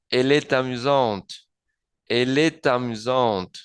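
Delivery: "est amusante" linked as "e-tamusante"; liaison, final T sounded. Shown as a demonstration. Teacher models "ella es divertida", "est amusante" joined with a T. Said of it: "hago la liaison".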